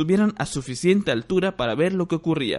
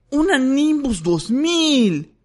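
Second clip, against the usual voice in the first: high, slow, envious groan; envious moan; 11 semitones higher, 5 dB louder, much slower than usual. Acted. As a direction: moan it out with longing. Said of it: "gimió Ron con envidia".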